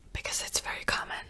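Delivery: ordinary talk, nothing marked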